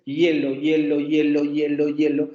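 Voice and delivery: HIELO chanted in a quick, even string; repeated over and over. Shown as a demonstration. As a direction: rattle off HIELO in a run, each starting on a Y glide. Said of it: "si ustedes pronuncian esta palabra muchas veces".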